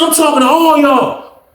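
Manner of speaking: high-pitched, acted angry retort; argumentative retort. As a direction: answer back across the cells, voice high. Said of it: "going back and forth on some loud shit".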